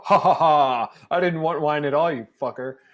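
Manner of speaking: taunting laugh-talk from the body; body laughing at her. Retold or imitated. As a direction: gloat over the bad wine. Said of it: "I can hear my body go".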